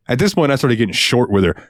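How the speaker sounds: normal talking voice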